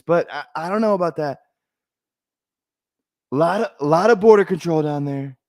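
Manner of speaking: high, lilting mock-presidential voice; Trump impersonation. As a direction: do a camped-up parody of a politician, pitched high.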